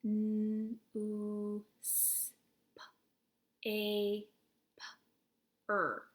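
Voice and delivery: N, oo, s, p, a, p, er said as separate phonemes; teacher segmenting a word into sounds; sounds one by one. Shown as a demonstration. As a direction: isolate each sound with a pause. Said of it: "let's do the sounds".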